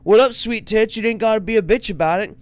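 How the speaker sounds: loud, high mock catcaller; hammed-up street heckling; brash catcall. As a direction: holler it as a cocky skinhead. Acting out skinhead's crude catcall.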